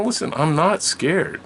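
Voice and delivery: put-on high, loud, drawn-out kid protest; insistent denial. Child insists he is not scared.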